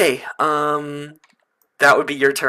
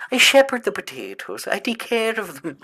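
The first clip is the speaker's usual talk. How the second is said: high put-on Irish accent; Irish potato shepherd voice; 9 semitones higher than usual. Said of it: "like, an Irish accent".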